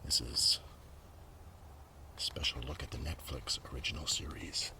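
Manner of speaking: ordinary delivery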